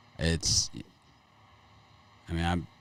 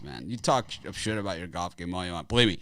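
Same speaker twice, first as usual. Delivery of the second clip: usual speaking voice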